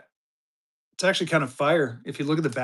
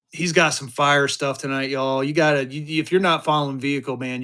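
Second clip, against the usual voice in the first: ordinary delivery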